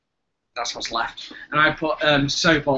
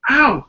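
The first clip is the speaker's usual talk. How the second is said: sharp yelp of pain; 10 semitones higher, 9 dB louder than usual. Real, not acted. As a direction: cry out in sudden pain. Sudden pain, loud outcry.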